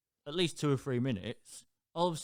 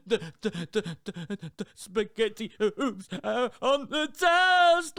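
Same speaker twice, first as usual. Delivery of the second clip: put-on high, loud, slow wailing little girl; hammed-up toddler complaint; upset toddler; 8 semitones higher, 7 dB louder, slower than usual. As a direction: imitate a sobbing small child. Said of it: "And she went".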